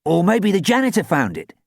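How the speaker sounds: high, loud, hopeful; bright suggestion. A narrator's reading. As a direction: float another idea brightly. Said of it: "Zac suggested brightly".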